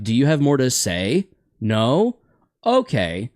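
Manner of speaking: rising endings on "say", "No" and "Okay"; mock uptone. Shown as a demonstration. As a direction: turn "say", "No" and "Okay" into questioning lifts. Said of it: "ends their sentences like this".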